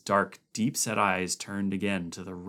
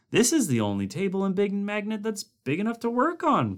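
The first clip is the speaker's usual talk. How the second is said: higher, earnest scientist voice; Blair pleading; 12 semitones higher, 4 dB louder, faster than usual. A narrator's reading. Blair defending his use of the table, earnest.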